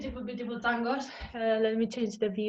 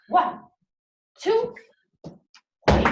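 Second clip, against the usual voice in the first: loud count, two called much higher; dance teacher calling the count over the music; 11 semitones higher, 8 dB louder than usual. Real, not acted. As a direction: call the beat to the class.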